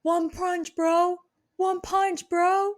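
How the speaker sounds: high, loud bro voice; tough guy voice. Retold or imitated. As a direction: bark it like a gym bro. Quoting Ronnie catchphrase.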